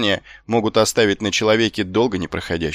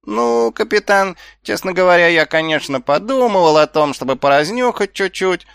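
high, louder, wheedling admission; slippery; 9 semitones higher, 4 dB louder than usual. A narrator's reading. Nobbs admits to the captain his snooping plan, slippery. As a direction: sidle into it, pitch held high. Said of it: "Вид у Шнопса стал ещё более скользким".

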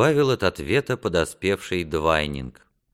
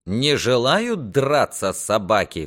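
higher, slow exclamation; mild indignant cry; 5 semitones higher, 3 dB louder, slower than usual. A narrator's reading. Knight learns the garrison won't fight, mildly indignant cry. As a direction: cry out in restrained outrage. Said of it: "вскричал Реморни".

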